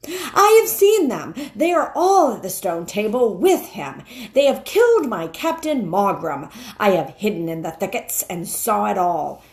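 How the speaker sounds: a wolf gasping out bad news, rising to a high cry; breathless alarm building into a frantic high fly fly; panicked wolf cry. Wolf warning the witch, frantic and loud.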